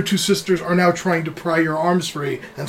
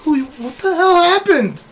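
high-pitched, startled outburst; shocked sister; 11 semitones higher than usual. Acted. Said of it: "Your other sister's like".

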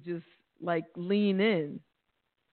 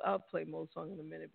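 ordinary talk, nothing marked

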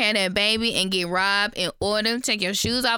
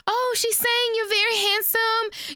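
fake sweet translation; 11 semitones higher than usual. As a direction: cover the mother's words with a bright high lie. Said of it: "Bae is like".

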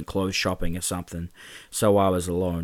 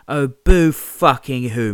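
slow, loud, higher mock pity; mocking sneer; 6 semitones higher, 9 dB louder, slower than usual. James mocking the kicked Mark, slow loud sneer. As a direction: drag it out to belittle him.

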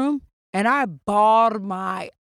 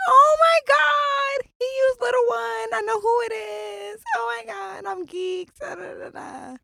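giddy squeal; 13 semitones higher than usual. Retold or imitated. Telling co-host how she reacted to his card, giddy squeal. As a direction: replay being over the moon, sky-high and stretched.

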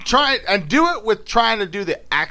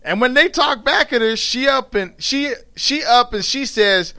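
higher, lively Southern dialect narration; storytelling in dialect; 8 semitones higher, 3 dB louder than usual. A narrator's reading. Recounting her mother's outburst, in animated Southern dialect. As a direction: tell it as a riled-up Southern storyteller.

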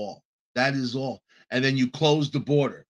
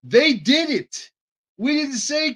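indignant outburst; 11 semitones higher, 6 dB louder than usual. Real, not acted. Ranting at fake sympathy.

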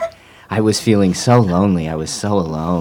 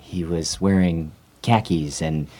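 usual speaking voice

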